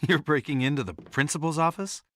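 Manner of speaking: startled at seeing the lock forced; incredulous. Acted. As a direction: ask it taken aback, pitch climbing at the end. Startled by her forcing the lock.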